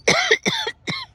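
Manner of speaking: sharp real cough, bug in throat. Real, not acted. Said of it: "It's stuck in the back of my throat".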